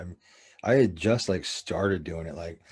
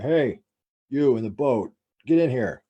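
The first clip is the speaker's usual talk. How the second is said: louder, raised mock police command; imitated cop calling out; 4 semitones higher, 7 dB louder, slower than usual. Cops hail the men in the boat, mock commanding shout. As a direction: call across the water in separated phrases. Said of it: "the cops would hit us at the spotlight".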